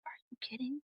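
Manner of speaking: whispered scolding to herself; whispered self-rebuke; inner-voice whisper. Retold or imitated. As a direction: hiss it at yourself under your breath. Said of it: "And in my mind".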